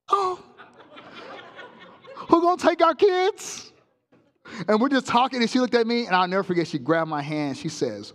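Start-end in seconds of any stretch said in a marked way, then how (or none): 0.1-3.7 high, laughing wife's worried voice. Retold or imitated. Wife answers his farewell, high laughing worried voice. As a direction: play the wife, pitched high and fretful. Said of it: "Listen, I love you".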